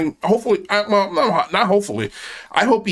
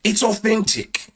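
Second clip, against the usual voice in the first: mock Australian; 4 semitones higher, slower than usual.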